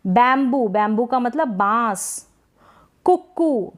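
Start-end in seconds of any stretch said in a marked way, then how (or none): 3.1-3.7 कुक्कू as "kuk-koo"; short first vowel, long last in कुक्कू; short u, double k in कुक्कू. Shown as a demonstration. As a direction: stop on the k in कुक्कू, then a long oo. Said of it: "इसको पढ़ेंगे कुक्कू".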